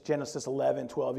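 ordinary talk, nothing marked

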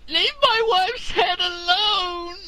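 high, loud, slow anguished cry; hammed-up tearful movie outburst; Brad Pitt crying. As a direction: scream it through mock tears. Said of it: "That's Brad Pitt".

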